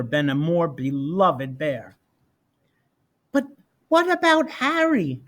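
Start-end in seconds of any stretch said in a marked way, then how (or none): 3.3-5.2 put-on higher child's voice, curious; a little boy asking eagerly; young Cole. A narrator's reading. Little son asking his mother about Harry, higher child's voice. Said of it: "Cole asked".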